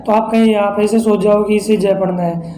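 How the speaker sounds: normal talking voice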